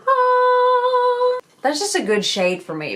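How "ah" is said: high, sustained heavenly "ahhh"; sung "ah". Real, not acted. Vlogger unveiling new bronzer to viewers, high sung "ahhh". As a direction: sing one long high reveal note.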